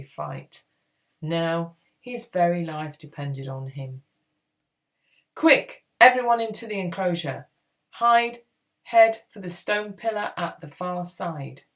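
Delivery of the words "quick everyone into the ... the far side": loud, urgent calling out; urgent orders. A narrator's reading. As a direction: shout orders to fleeing companions. Urgent orders while fleeing danger.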